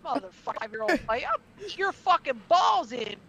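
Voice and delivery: high, loud yell at the announcer; retold yell. Telling off the ring announcer who insulted a child, loud yell. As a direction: let it rip like the moment itself. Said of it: "and I went".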